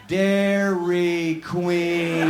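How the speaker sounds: "Dairy Queen" drawn out syllable by syllable; extremely slow. Acted. Waitress telling tourists the place's name, exaggeratedly slow. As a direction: stretch each sound of "Dairy Queen" very long. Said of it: "would you say it real slowly".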